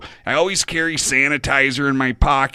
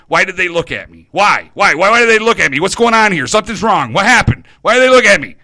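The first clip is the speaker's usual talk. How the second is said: louder and higher, spiralling into panic; panicked questions building to a near shout; rising panic; 6 semitones higher, 9 dB louder, faster than usual. Retold or imitated. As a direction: voice climbing with fear, much louder.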